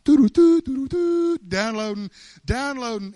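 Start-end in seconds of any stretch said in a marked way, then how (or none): 0.0-1.4 very high, loud computer doo-doo-doo; piercing sing-song machine beeps by mouth; download noise imitation. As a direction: mimic an old computer's download tones, loudly. Imitates old computer downloading a file.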